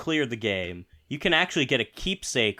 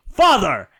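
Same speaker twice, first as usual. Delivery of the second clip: loud calling cry; calling shout; 5 semitones higher, 9 dB louder than usual. Retold or imitated. Son calling his father in battle, shouting. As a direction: yell out for your dad. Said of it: "one of the interactions is just".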